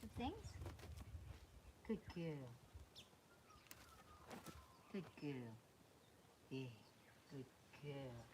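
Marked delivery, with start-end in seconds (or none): 1.9-8.2 much lower and much softer, slow and calming; gentle soothing praise. Real, not acted. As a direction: murmur it low to settle a skittish mare. Soothing horse during training.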